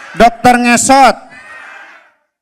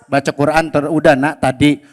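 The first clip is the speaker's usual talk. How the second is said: lower, calm fatherly reply; 9 semitones lower, 5 dB quieter than usual. Acted. Father answering his son Abdi, low and calm. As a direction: answer as a gentle dad.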